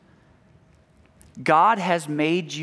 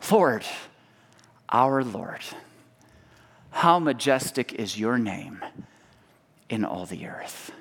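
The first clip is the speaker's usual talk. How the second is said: solemn psalm reading; 5 semitones lower, 3 dB quieter than usual. Acted. Psalm read in worship.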